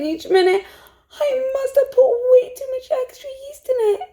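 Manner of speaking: plain reading voice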